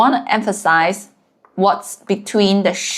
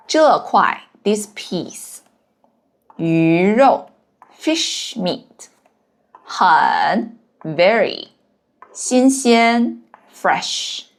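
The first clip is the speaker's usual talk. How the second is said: slow glossing; slower than usual. Shown as a demonstration. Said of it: "teacher glosses words slowly".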